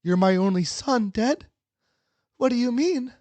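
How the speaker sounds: high, pleading mom voice; a worried mother questioning her son, pitched high; shaken mother. Acted. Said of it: "She shook".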